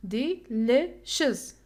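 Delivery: "delicious" split slowly into de, li, shus; slow teacher's breakdown of "delicious", one syllable at a time. Shown as a demonstration. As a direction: break "delicious" into three parts with gaps. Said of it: "The stress is here".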